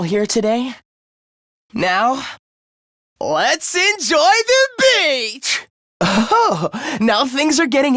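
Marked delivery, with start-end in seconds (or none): 1.7-5.7 high, drawn-out holiday cry; exuberant cheer. Acted. Leader calling the group to enjoy the beach, exuberantly. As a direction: stretch it out with gleeful excitement.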